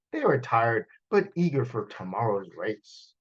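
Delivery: ordinary delivery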